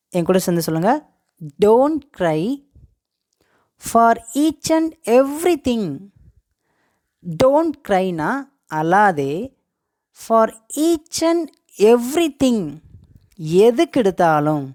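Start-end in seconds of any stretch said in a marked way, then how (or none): 1.6-5.8 word by word, with pauses; slow model phrase. Shown as a demonstration. Teacher has learners repeat along, slow and deliberate. 10.3-12.6 slow, higher, word-by-word delivery; slow model phrase. Shown as a demonstration. Teacher breaks down the English phrase, slow and deliberate.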